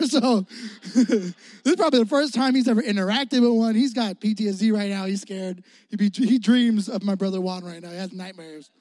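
usual speaking voice

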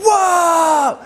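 very high shouted whoa of amazement; shocked whoa. Retold or imitated. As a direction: show the kid the face of total wonder. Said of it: "then he did it himself".

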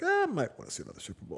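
high, fast mock Tom Brady voice; playful quarterback impression. Host imitating the old quarterback, high and fast. Ageing quarterback moves to Tampa.

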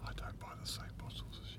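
fast hushed whisper. Real, not acted. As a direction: whisper it quickly. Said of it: "Why are we whispering?".

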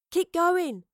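high, loud shouted order; urgent shout. A narrator's reading. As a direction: shout to urge the rider on. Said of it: "shouted Astrid".

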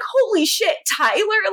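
high-pitched retold astonishment; lightly acted disbelief on seeing someone alive; mildly tearful shock. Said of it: "Like, she starts crying".

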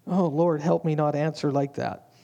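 ordinary delivery

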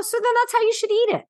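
own retort. Retold or imitated. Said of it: "I go".

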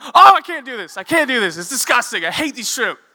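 high, loud panicked outburst; disgusted freak-out. Retold or imitated. Hand in the slimy shrimp bucket, disgusted freak-out. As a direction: wail it in hammed-up revulsion.

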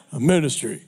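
solemn minister voice. Retold or imitated. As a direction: drop into a pious churchy tone. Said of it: "I had to change my voice".

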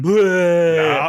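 loud high Bleh shout; loud blurt. Retold or imitated. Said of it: "like, going".

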